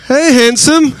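loud, high, bursting hello to a friend; excited greeting. Retold or imitated. Greeting Sean Bellamy spotted in the shop, loud and thrilled. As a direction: blurt it out with glee. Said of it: "I think I was just excited".

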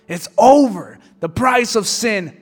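loud triumphant demon cry; gloating shout. Acted. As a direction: roar it over the crowd of hell.

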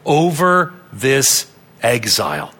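three words spaced out slowly and deliberately; slow emphasis. Real, not acted. Preacher stressing God's control over exile, slow and deliberate. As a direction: weigh out "over this exile" word by word.

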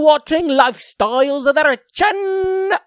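loud, high, slowly drawn-out announcer voice. Acted. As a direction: belt it out high, stretching the words grandly. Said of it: "is actually [name]".

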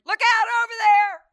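high, loud frantic shriek; mimicked panicked mother in the passenger seat; mom screaming. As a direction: yell a warning like a scared mother. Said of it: "her screaming and hollering".

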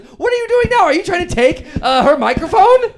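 loud, high-pitched disbelief; scandalized host calling out a guest; shocked objection. Acted. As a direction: raise the voice in alarmed protest. Host catches guest grabbing microphone.

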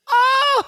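very high, loud "ohh" cry; excited squeal. Real, not acted. Fan recalls first big-screen viewing, excited squeal. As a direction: squeal with delight. Raves about seeing it on TV.

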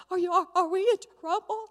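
put-on high, loud child voice, stammering; worried kids. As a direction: imitate anxious little girls. Voices scared girls in the car.

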